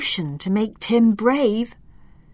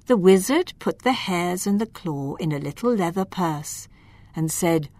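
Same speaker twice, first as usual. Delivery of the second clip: ordinary delivery, nothing marked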